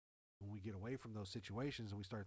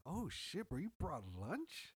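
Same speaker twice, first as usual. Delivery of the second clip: surprised; 6 semitones higher, much slower than usual. Retold or imitated. Retelling surprise at coworker's lunch.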